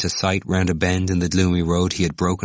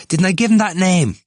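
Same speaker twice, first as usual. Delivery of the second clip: much higher, louder insistent question; excited crowing; 13 semitones higher, 7 dB louder than usual. A narrator's reading. Temple claiming he coined the name, excitedly insistent. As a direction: demand the credit at the top of your range. Temple crows about the nickname.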